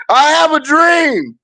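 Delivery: high, slow, drawn-out oratory; Martin Luther King. Acted. Invoking a civil rights leader to the bro, mock oratory. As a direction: proclaim it like a famous speech, stretched out. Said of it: "I wanted to invoke Martin Luther King".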